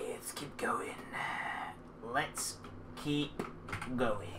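unvoiced hush; whispering to sneak past. Real, not acted. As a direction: whisper so it can't hear.

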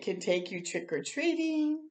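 ordinary delivery, nothing marked

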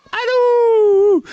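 squealing hello to a newborn goat. Real, not acted. Calling the kid in baby voice.

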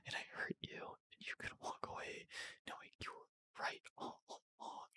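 acted emotional apology, very soft; emotional whisper. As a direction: whisper a hurt, remorseful confession. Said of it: "Get emotional".

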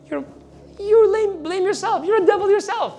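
high scornful taunting devil; devil's scornful voice. Acted. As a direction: sneer it back at them. Devil rebukes listeners.

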